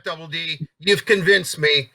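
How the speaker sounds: usual speaking voice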